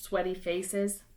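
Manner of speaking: ordinary delivery, nothing marked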